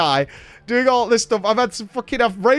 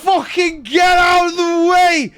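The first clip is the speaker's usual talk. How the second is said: put-on drunk angry shout; 8 semitones higher, slower than usual. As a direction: bellow it like a furious drunk old man. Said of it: "he says this as he walks through".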